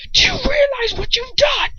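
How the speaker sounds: high furious shout; sudden snap. A narrator's reading. Lucius snapping at the bleeding narrator in fury. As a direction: snap it out in a rage. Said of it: "suddenly snaps".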